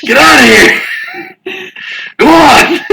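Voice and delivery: hammed-up driver chasing someone off; mock yelling. Friends join acting out the driver.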